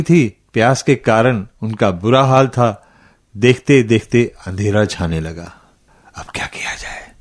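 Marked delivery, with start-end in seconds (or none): 6.2-7.1 hushed, voiceless whisper to himself; whispered thought. A narrator's reading. Lost traveler thinking in the dark, whispering to himself. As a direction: whisper it as a worried private thought.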